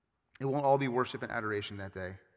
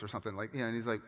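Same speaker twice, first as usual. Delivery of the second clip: ordinary talk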